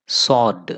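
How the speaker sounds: సార్డ్ with S straight into the vowel; silent W. Shown as a demonstration. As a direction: say సార్డ్, no W after S. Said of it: "డబ్ల్యూ silent అనమాట ఇక్కడ". (సార్డ్, డబ్ల్యూ పలకకుండా)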